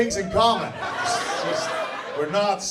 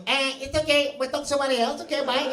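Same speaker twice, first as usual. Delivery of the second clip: higher-pitched, uneasy excuse to get away; nervous brush-off; 7 semitones higher than usual. Acted. People hearing the Eastern European accent, nervous brush-off. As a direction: back out of the chat in a high voice. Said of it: "they go like".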